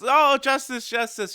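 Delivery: lightly imitated delighted onlooker; Zeno-sama. Imitating a god loving the attacks, playfully delighted. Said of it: "And Zeno-sama's".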